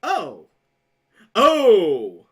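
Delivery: loud, drawn-out oh of sudden understanding; oh, now I get it; dawning realization. Retold or imitated. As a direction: it all clicks at once. Realizing the shirt is satanic.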